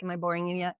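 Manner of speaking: usual speaking voice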